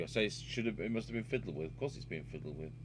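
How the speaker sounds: ordinary delivery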